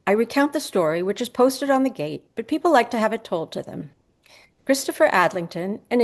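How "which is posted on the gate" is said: ordinary delivery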